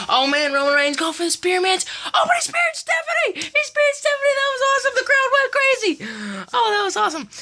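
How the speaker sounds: high, shouted, breathless calling; thrilled play-by-play. Pretend. Fan calling Reigns spearing Stephanie, thrilled shout. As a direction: scream it like the crowd going crazy.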